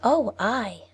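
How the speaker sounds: put-on high, light little girl voice; a sweet child answering the wolf. Little girl telling the wolf her errand, high and sweet.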